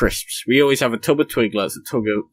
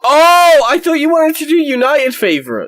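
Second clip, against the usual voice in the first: exasperated shout; 14 semitones higher, 7 dB louder than usual. Real, not acted. Heated mock argument over favourites.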